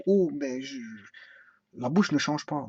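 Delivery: usual speaking voice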